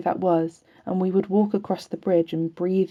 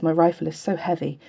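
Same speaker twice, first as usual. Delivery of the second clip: plain reading voice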